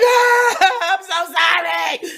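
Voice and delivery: loud, high mock wail; a hammed-up sobbing apology, drawn out; mock crying. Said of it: "I'd have been crying and all that".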